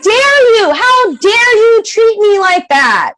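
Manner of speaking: high, stretched-out righteous outrage; indignant anger. Acted. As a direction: confront the offender, drawing out each accusation. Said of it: "You need to be angry".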